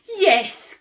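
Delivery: high, loud happy yes; cried yes. Acted. Witch welcoming the bird aboard, high excited cry. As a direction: burst out a bright yes. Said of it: "cried the witch".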